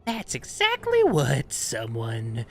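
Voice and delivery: put-on raspy old hag, suspicious. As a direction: croak it like a wary crone. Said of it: "Grizz's hag's voice".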